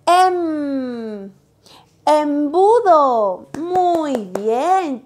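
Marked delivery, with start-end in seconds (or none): 0.1-1.3 "em" held with a hum; blended syllable. Shown as a demonstration. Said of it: "y digo". 2.0-3.5 "Embudo" very slow and louder; slow modelled word. Shown as a demonstration. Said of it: "teacher models word".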